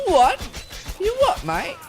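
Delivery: squeaky, high, loud British bandit "You what, mate?"; mock British bandit. Mocking game bandits, a shrill mock British accent. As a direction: ham up a dim British thug in a high yelp. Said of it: "simpleton bandits always have a British accent".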